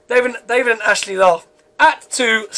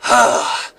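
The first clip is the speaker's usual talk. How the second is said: voiceless breathy sigh; heavy sigh. A narrator's reading. Elliot seeing guards turn demon, sighing. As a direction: let out an audible sigh. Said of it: "Elliot sighs".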